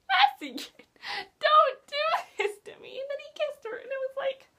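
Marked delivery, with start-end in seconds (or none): 0.1-3.0 laughing sob. Retold or imitated. Pleading with a dying character.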